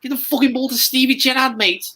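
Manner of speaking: very high, frantic Scouse fan; Scouse accent. Acted. Liverpool fan demanding the ball for Stevie, high Scouse accent. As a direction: yelp it at the players in squeaky Scouse. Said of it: "Can you do a Scouse accent?".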